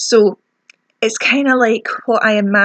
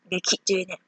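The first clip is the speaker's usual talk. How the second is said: softer, teasing wasp voice that fades; a lightly voiced nasty wasp; spiteful wasp; 2 semitones lower, 7 dB quieter than usual. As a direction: taunt like a wasp that won't stop. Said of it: "a wasp going".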